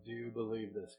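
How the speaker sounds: quiet, searching "Do you believe this"; quiet repeat. Real, not acted. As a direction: drop it down to a hush.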